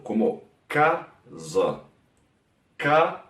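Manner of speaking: "casa" with a z sound and a reduced final a; Portuguese casa. Shown as a demonstration. Teacher showing students the Portuguese word, careful demo. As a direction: sound out "casa" slowly, final vowel swallowed. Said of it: "que se queda un poco más bajo".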